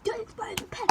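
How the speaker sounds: high mock yell; mock shout. Classmate yelling at the pen thrower, high mock shout. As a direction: squeal it out like a panicked classmate. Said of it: "legit shouting".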